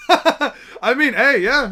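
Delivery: loud, high amused laughter in the words; real laugh breaking into speech. Real, not acted. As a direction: talk through a laugh. Streamer laughs at magical remark.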